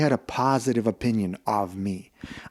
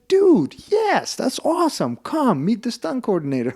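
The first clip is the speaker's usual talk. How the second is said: high-pitched, eager imitation of a buddy; excited agreement; 11 semitones higher than usual. Stuntman welcomes actor to practise, imitated high excitement.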